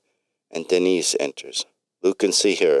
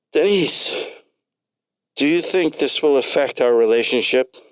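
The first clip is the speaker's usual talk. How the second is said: calling out; 8 semitones higher than usual. Acted. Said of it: "calls out".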